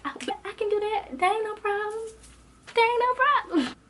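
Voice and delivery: very high, sing-out sassy assurance; cocky readiness. Retold or imitated. Student told to say how she feels, cockily eager. Eagerly takes up teacher's invitation.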